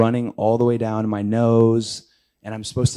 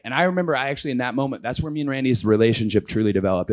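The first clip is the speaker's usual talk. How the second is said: usual speaking voice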